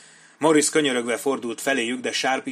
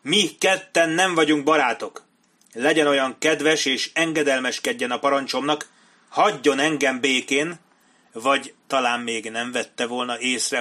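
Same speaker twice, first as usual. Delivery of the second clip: raised, loud, scornful order; angry, pulling rank; 3 semitones higher, 6 dB louder than usual. Acted. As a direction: put the junior officer in his place. Captain pulls rank on Sharp. (ingerült, fölényes rendreutasítás)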